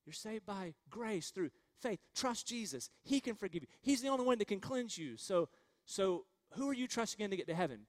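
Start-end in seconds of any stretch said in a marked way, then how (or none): none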